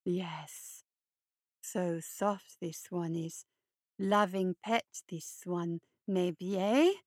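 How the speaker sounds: low, slow, creepy hare voice; low creeping monster croon; hulking hare creature. Acted. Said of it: "he suggested".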